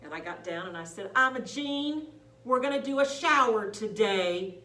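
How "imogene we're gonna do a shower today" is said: loud, slow, carefully spaced words; clear and loud. Retold or imitated. Caregiver at Imogene's better ear, loud clear announcement. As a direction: speak up for a hard-of-hearing listener. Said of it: "speak clearly and loud".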